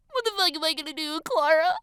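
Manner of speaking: high, hurried helpless whine; whining. A narrator's reading. Distraught girl to friend, whining. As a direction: whine it on the edge of sobbing. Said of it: "she whines".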